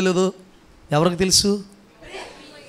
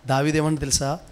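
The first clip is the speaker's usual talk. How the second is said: ordinary delivery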